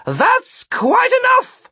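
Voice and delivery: loud, high-pitched bellow, slowing at the end. A narrator's reading. Sid breaking up the fight from the crowd, bellowed. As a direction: bellow an order to stop across the square. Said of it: "but bellowed".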